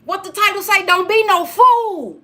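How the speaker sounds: ordinary talk, nothing marked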